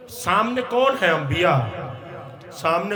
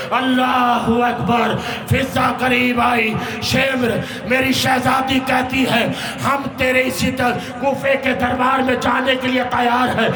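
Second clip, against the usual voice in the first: impassioned lament preaching; 5 semitones higher than usual. Acted. Preacher voicing captives and their captor at Kufa's gate, impassioned lament. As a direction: preach the exchange with mounting urgency. Preacher dramatizes the exchanges at Kufa.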